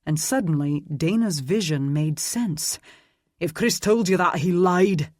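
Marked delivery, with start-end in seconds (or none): 3.4-5.1 angry growl, louder. A narrator's reading. Aidan denying the killing to Roland, angry growl. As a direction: spit the denial back at him. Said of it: "Aidan growled".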